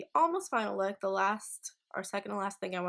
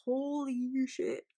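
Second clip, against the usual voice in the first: amazed exclamation; 6 semitones higher, 4 dB louder, much slower than usual. Real, not acted. Amazed by the glow.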